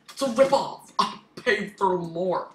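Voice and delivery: loud, high, indignant ripoff cry; outraged complaint. Real, not acted. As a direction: complain loudly like you were cheated. Complaining about shop price.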